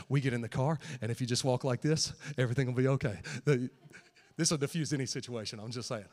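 ordinary talk, nothing marked

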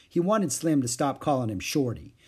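ordinary delivery, nothing marked

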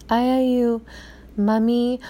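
put-on high little toddler voice with a pause; lightly voiced small boy, halting; small child voice. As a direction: say it like a toddler just learning words. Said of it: "he'll be like".

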